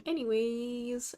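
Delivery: ordinary talk, nothing marked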